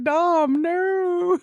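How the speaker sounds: high wailing mock sob over a dead friend; mock crying. Gamer wailing at a cutscene, high mock sob. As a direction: ham up the grief, voice climbing high. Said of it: "you're crying because you're like".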